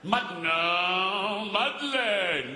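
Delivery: very slow, stretched-out protest; exasperated. Acted. Dramatist despairing of Madeleine on the phone, exasperated. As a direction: draw every vowel out in dismay.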